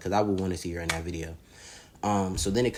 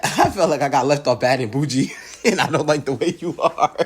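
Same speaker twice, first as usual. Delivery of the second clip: laughing quote; 6 semitones higher, 7 dB louder than usual. Retold or imitated. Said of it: "She said".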